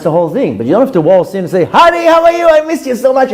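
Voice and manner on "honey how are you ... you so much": high, singsong, over-sweet greeting; mock gushing husband. Husband waltzing in to greet his wife, mock gushing. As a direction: gush it in a raised, hammed-up voice.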